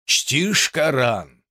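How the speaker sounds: slow, heartfelt question; soulful. Acted. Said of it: "проникновенно спросил суфий".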